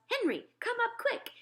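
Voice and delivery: high-pitched call for help; mother's shrieky yell, held back in volume; mock yell. A narrator's reading. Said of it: "she yells".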